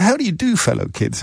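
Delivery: high-pitched opening, dropping on the address; Steve Buscemi line. Retold or imitated. Too-old man posing as a teenager, mock chummy greeting. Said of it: "that Steve Buscemi said".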